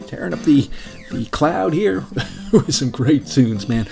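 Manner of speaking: normal talking voice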